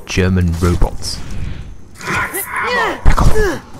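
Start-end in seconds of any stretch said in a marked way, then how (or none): 2.0-3.8 shout. Real, not acted. Yelling at game enemy.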